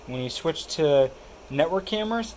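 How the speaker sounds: usual speaking voice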